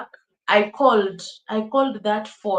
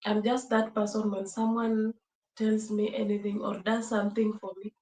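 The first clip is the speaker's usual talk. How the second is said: normal talking voice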